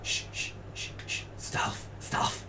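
very soft, voiceless shushing; playful secretive whisper; hushed stealth whisper. Pretend. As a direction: hush them as if sneaking.

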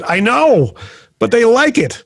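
high-pitched protest. Retold or imitated. Acting out his embarrassed protest to housemate.

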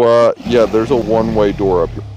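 usual speaking voice

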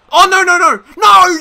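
high, loud yelled no's; furious shout. Real, not acted. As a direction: scream it as the chance is lost. Chance ends badly.

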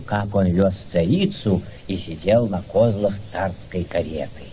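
ordinary delivery

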